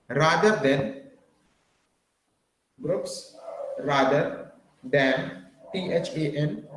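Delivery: slow, spaced, each word repeated; slow dictation. Shown as a demonstration. Teacher dictating to slow-writing students, slow and spaced. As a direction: dictate phrase by phrase, spelling key words. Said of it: "एक एक शब्द बोल रहा हूँ मैं".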